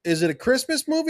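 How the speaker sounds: mock skeptical question. Imitating a skeptical friend.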